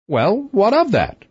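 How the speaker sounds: high-pitched queenly comeback; queen's rejoinder. A narrator's reading. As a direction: brush off the king's worry in a high voice. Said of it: "rejoined the queen".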